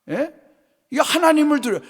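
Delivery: ordinary delivery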